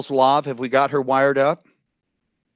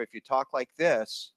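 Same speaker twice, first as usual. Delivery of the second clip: much softer, distant from the microphone; off-mic; 10 dB quieter than usual. Shown as a demonstration. Host warning audience how not to use the mic, faint off-mic. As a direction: talk faintly, as if missing the mic. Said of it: "it's not good".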